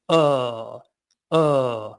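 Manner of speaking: uh from low in the throat, short and dark; deep throaty uh sound. Shown as a demonstration. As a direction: drop the floor of the mouth on uh. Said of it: "take away the C and go".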